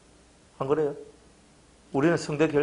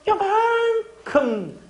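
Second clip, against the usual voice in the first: very slow, higher mocking drawl; mocking tiny; 3 dB louder, much slower than usual. Pastor mocking believers who give only a little, drawn out. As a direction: stretch it out to mock how little.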